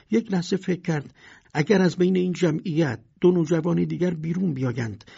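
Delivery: plain reading voice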